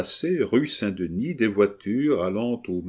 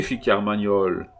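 plain reading voice

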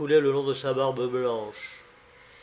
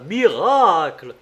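loud, drawn-out exclamation; crowd's cry; 3 semitones higher, 7 dB louder, slower than usual. A narrator's reading. Crowd seeing two old people so alike, crying out. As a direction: cry it out in amazement. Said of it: "la foule cria".

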